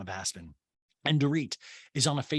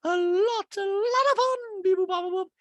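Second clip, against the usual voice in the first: put-on Dorit's baby talk; slower than usual. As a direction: coo it to a toddler. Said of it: "And Dorit's like".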